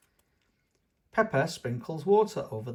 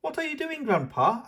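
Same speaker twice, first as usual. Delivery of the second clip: high, bright little piggy voice; squeaky child asking grandpa; little girl pig asking a question; Peppa's voice; 13 semitones higher, 3 dB louder than usual. Acted. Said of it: "asks Peppa".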